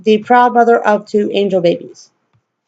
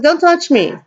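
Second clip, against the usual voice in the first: higher retold warning; 8 semitones higher than usual. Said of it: "I was like".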